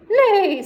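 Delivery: high, loud neigh, falling at the end; whinny it out like a horse. Acted. The horse neighs in alarm, high and loud. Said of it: "said the horse".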